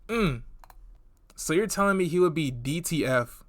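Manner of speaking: ordinary talk, nothing marked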